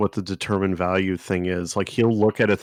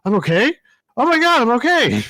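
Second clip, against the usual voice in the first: mock relief; 5 dB louder than usual. Donut suddenly fine, voiced.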